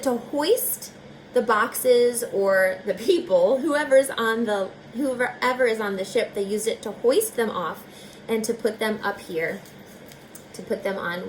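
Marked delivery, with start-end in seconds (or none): none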